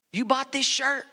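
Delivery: normal talking voice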